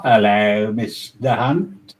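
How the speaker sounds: ordinary delivery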